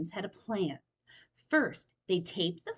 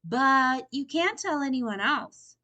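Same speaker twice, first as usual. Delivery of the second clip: slow, higher, careful words; slow warning; 6 semitones higher, 6 dB louder than usual. A narrator's reading. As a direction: spell out the condition slowly. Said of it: "Franklin said slowly".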